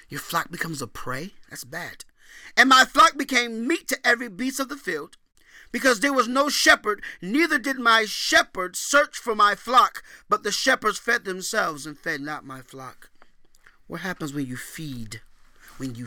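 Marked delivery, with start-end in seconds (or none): 2.6-13.1 loud, high preached scripture; scripture reading. Acted. Preacher booming scripture to the congregation. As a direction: proclaim the verses like a prophet.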